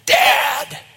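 full-voiced, high shout; huge, high call across a distance; ear-splitting cry. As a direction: yell for your father at full force. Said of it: "I'd blow your ears off".

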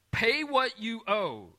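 mock stern boss demanding payment; demanding master. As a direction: bark out the debt owed. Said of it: "is what he says".

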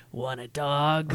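slow low creepy offer; creepy disembodied voice. Pretend. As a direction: drawl it slowly like a lurking killer. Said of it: "you just hear a disembodied voice say".

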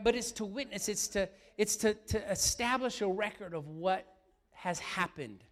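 ordinary delivery

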